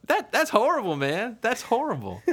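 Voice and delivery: high, pained sympathy; sympathetic dismay. Retold or imitated. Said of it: "I feel bad".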